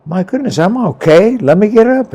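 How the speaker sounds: high-pitched, lively protest; retold insistence. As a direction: insist you're okay, pitched well up. Retold waking up, insisting he's okay.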